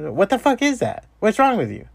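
aghast, high and sharp; incredulous. Retold or imitated. Ranting at mumblers, high disbelieving outburst.

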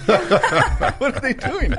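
very high, slow, laughing cry; laughing disbelief. Retold or imitated. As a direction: squeal out baffled amusement. Chekhov's baffled outburst, laughing.

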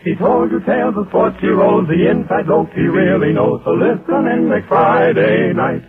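normal talking voice

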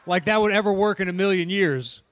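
usual speaking voice

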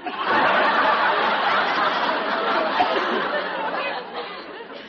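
studio audience roaring with laughter; big burst of crowd laughter. Real, not acted.

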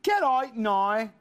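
slow, drawn-out Belfast accent; Irish accent. Shown as a demonstration. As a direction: demonstrate an Irish accent, OUT as "ite", NOW as "nigh". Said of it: "this is an Irish. See if you can do this".

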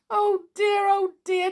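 lamenting. Acted. Clown lamenting to Jolly about his rusty key, wailing. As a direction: moan it in dismay.

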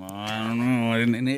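a hint of a disinterested actor; Harrison Ford impression. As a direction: mumble it like a bored star. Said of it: "Harrison Ford's just like".

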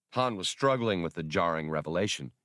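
ordinary delivery

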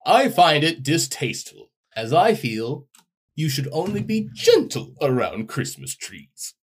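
lightly high prim Santa; a hint of prissy Santa. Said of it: "you're gonna be, uh, Santa".

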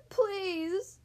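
high storybook wail. A narrator's reading. Suffering fox begging the dentist, acted wail. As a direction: plead in a high, mild wail. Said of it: "the fox wailed".

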